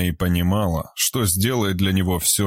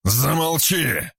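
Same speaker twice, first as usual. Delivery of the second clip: slow, higher angry snap; snarled; 8 semitones higher, slower than usual. Acted. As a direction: bark it to silence her. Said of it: "рыкнул на неё Виктор".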